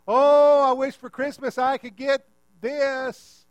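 high, very loud mock wishing aloud; hammed-up, drawn-out hint. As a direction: announce it so the next room hears. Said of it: "Speak loud enough so that".

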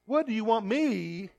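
"What do you want me" drawn out slowly, rising; slow, rising reading of "What do you want me"; slow deliberate reading. Shown as a demonstration. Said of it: "how he said that".

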